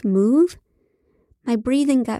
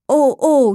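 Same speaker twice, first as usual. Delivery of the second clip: loud, higher mock-ecstatic cries; yelled moans; 4 semitones higher, 6 dB louder than usual. A narrator's reading. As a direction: yell out faked moans. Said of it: "he yelled".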